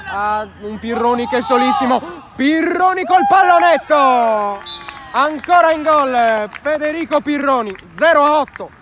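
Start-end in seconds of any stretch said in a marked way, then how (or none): 2.3-8.7 breathless italian goal commentary. Real, not acted. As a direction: call the lob goal with a shout. Eighth goal called live. (telecronaca concitata del gol)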